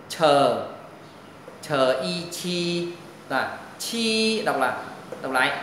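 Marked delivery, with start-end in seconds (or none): 0.1-4.4 "chờ" with a strong burst of air, then "chi"; aspirated sound. Shown as a demonstration. Teacher spells out the hard sound for students, aspirated sound. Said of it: "âm hơi gió bật hơi".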